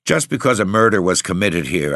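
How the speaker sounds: usual speaking voice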